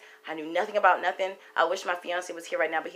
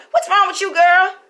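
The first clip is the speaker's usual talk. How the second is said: startled fiance; 11 semitones higher, 9 dB louder than usual. Retold or imitated. Fiance startled by her jump.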